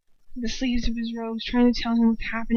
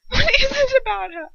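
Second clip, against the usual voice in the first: much higher, louder jealous cry; jealous Bellatrix; 13 semitones higher, 5 dB louder than usual. A narrator's reading. Bellatrix demanding why Lucius loves the girl. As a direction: demand an answer.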